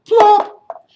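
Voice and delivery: very high loud popping plop; sound-effect plop. Shown as a demonstration. Teacher leading the children in a loud high plop. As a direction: pop it like a stone in water. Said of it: "let's all say plop together".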